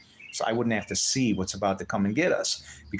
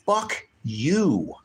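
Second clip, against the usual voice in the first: real furious curse, louder; angry outburst; 5 semitones higher, 4 dB louder than usual. Real, not acted. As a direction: spit it at the critic. Angry rant at a critic.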